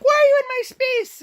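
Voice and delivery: high, loud, fast shriek; teen freakout. Retold or imitated. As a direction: shriek it like a furious teenager. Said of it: "she freaks out".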